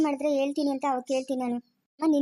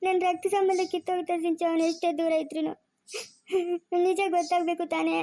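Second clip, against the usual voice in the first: sobbing mother, higher voice; crying; 3 semitones higher than usual. Acted. Mother weeps to her daughter that he is still her child, crying. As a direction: break into sobs between phrases. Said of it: "ಅಮ್ಮ, ಅಳ್ಬೇಡ".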